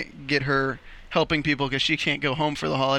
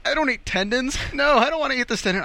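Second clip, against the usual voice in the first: rising higher and faster in fussy disgust; imitating a picky star who gets more and more grossed out; hammed-up fussy panic, higher and quicker; mock disgust; 7 semitones higher than usual. James panicking over a tendon.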